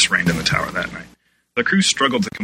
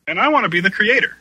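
higher, louder eager claim; eager exclaim; 13 semitones higher, 4 dB louder than usual. A narrator's reading. Kesset claiming the creator job, eagerly exclaiming. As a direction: blurt it out with excitement. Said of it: "Kesset exclaimed".

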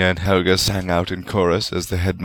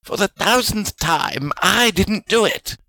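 protesting; 13 semitones higher, slower than usual. A narrator's reading. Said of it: "Salazar protested".

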